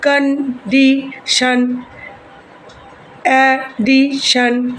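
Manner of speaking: Condition, addition very slowly, in three beats each; condition, addition with a gap after every syllable; split into syllables. Shown as a demonstration. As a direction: break it up as con - di - shan, a - di - shan for condition, addition. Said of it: "এইভাবে যদি আমরা লিখি".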